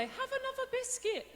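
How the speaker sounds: very high, put-on doting voice; mock-tender high voice; playful coaxing. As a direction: coax a loved one in a squeaky coo. Coaxing boyfriend while stroking his belly.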